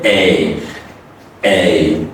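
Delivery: long A gliding from A to E; low, drawn-out long "A". Shown as a demonstration. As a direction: draw "A" out as two sounds. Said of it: "This is the long A".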